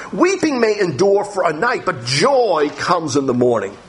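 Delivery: preacher reading a psalm to the congregation, solemn and lifted; scripture reading. Acted. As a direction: read the verses out like a prayer. Reads verse four aloud.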